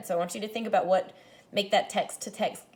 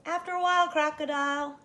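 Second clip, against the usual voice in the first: calling back; 7 semitones higher than usual. Acted. The boy calls back to Daddy at bedtime, playful reply. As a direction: answer the rhyme back, playful. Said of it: "I called back".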